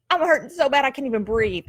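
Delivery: ordinary talk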